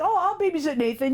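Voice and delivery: high-pitched imitated eagerness; eager volunteers. College students offering to babysit the church's only baby, eager. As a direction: jump in brightly as a keen volunteer.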